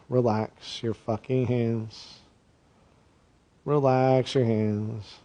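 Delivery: slow, soft calming meditation voice; guided meditation. Acted. Comic guru guiding angry workers, soothing. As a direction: ease them down body part by body part.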